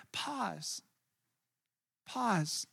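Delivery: dropped to a near hush; soft pause. Shown as a demonstration. Pastor softly echoing his own advice, quiet and hushed. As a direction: let the word fall away softly. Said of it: "pause repeated as advice".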